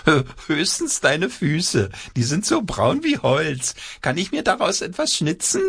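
high teasing puppet voice; cheeky puppet voice. A narrator's reading. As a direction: tease the girl as a cloth puppet. Hand puppet speaks to girl.

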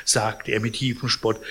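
ordinary delivery, nothing marked

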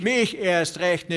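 a third man outdoes them; trotzig. A narrator's reading. Chorus of ranchers. (höher, langsam trotzig)

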